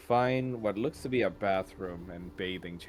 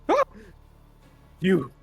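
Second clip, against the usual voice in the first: high, loud startled cry; startled outburst; shocked gasp; 14 dB louder than usual. Acted. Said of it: "Emma just gasps and goes".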